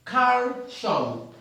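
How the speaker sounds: "calcium" said as kar-shom, wrong; "calcium" को कार्शम जैसा ग़लत बोलो. Shown as a demonstration. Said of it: "It's not calcium".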